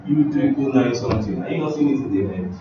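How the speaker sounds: ordinary talk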